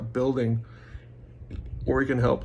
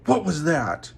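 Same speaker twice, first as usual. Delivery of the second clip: raised, higher-pitched yell; 5 semitones higher than usual. Retold or imitated. Speaker retelling how he yelled at a girl at work, yelled. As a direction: yell it at her in angry disbelief. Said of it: "And I yelled at her and I go".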